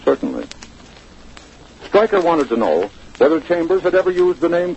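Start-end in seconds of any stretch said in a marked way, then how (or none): 0.1-0.4 low, offhand shrug of a word; casual, almost bored. Retold or imitated. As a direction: toss it off as if nothing matters. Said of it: "in that casual, almost bored voice".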